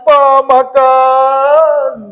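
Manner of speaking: high, loud, long-drawn mock whine; hammed-up whiny plea, stretched out; mock child whining. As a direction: imitate a wailing child begging Dad for food. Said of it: "dia merengek-rengek".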